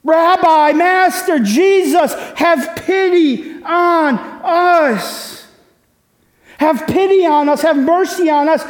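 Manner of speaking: loud, high pleading cry to Jesus. Retold or imitated. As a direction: call out desperately from afar, begging for pity. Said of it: "they called out in a loud".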